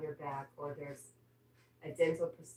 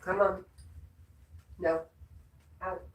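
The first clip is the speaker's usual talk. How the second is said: loud, sharp no to the dog; scolding the dog; 2 semitones higher, 9 dB louder than usual. Real, not acted. Owner scolding her dog Jack at the door, loud. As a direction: stop the dog in its tracks.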